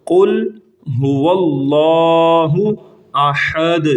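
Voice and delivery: very slow, drawn-out tajweed chant; slow devotional recitation; Quranic recitation. Shown as a demonstration. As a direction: recite each word stretched and melodic. Recites corrected verse.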